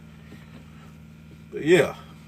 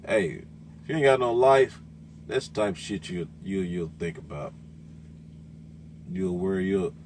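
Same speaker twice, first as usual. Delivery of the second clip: ordinary talk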